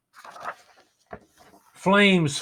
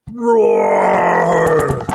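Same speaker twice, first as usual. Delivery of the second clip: big shouted dragon roar; 3 semitones higher, 8 dB louder than usual. Acted. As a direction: roar like a dragon to thrill the kids. Dragon appears in picture.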